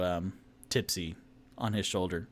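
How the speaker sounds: ordinary delivery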